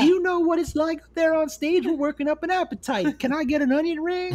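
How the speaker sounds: a high, girly mock stripper. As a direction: play a stripper begging for food. Voicing a stripper in a story.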